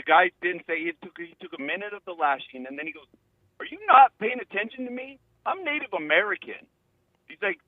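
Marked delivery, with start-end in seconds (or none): 3.6-6.7 higher, imitated indignant challenge; indignant retort. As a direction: retell his affronted comeback in a raised pitch. Retold indignant retort to accuser.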